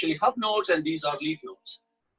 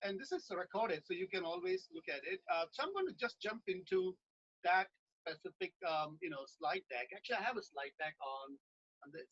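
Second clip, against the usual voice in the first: very faint, far from the mic; barely audible; 15 dB quieter than usual. Real, not acted. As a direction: voice fading out, barely heard. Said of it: "Your audio just went very quiet".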